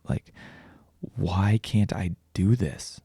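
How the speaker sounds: normal talking voice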